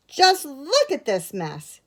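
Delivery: high, loud, slow scolding; stern telling-off from a grown-up. A narrator's reading. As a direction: scold with drawn-out emphasis. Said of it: "he said sternly".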